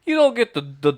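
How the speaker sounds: high, loud put-on voice; Tony. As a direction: push it up high with stuttering build. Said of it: "when you do the Tony voice".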